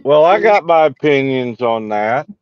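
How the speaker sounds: usual speaking voice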